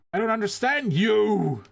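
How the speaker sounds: "understand" drawn out high and loud, slow; dramatic odd emphasis. Acted. Reading the game's dialogue aloud, stressing "understand" high and loud. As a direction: overplay "understand" like a melodramatic cutscene. Reading game line with flourish.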